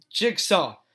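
sharp shout. Acted. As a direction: bark her name to stop her. Stomps hoof, cuts her off.

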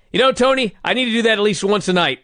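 high, fast manic impression of Sting. Partner mimics Sting's promo to his cohost, manic and high. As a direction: squeal it like a wired wrestler. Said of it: "He just shrieks and he goes".

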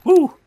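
high, loud whoop of joy; excited whoop. Real, not acted. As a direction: let out a big cheering woo. Excited cheer while playing.

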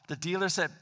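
ordinary talk, nothing marked

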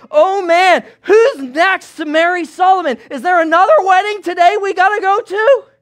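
ordinary delivery

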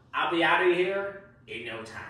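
ordinary delivery

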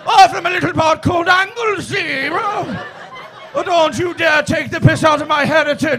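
hammed-up Welsh shouting; mock Welsh outrage. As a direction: yell like an offended Welshman guarding his heritage. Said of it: "And then all of a sudden".

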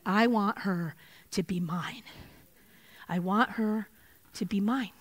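soft, slow and tender; a gentle, lightly voiced divine yearning; tender longing. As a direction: say it like a father claiming his child. Voicing God's longing for her.

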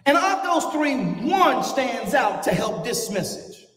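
preaching at full force. Acted. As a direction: preach it out, building the fire.

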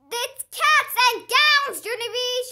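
high, loud, slow excited shout. Acted. Lucille shouting the news to Junie, high loud and thrilled. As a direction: yell the big news at your friend. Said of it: "she shouted".

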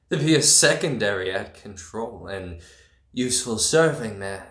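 ordinary talk, nothing marked

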